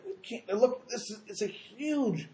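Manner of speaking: hammed-up wail of disbelief, swelling at the end; mock tearful awe. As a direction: imitate the righteous overwhelmed to tears, stretching the words. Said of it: "And why the Tzaddikim crying?".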